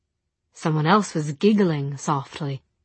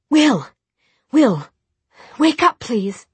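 higher, louder pleading calls; urgent calling; 7 semitones higher, 6 dB louder, slower than usual. A narrator's reading. Tessa shaking feverish Will to wake him, urgent calls. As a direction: call out to rouse a sleeper.

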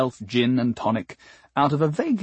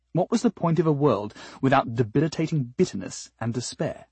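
ordinary delivery, nothing marked

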